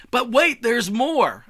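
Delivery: high, loud mock sales pitch; infomercial announcer. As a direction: belt it like a hammed-up TV pitchman.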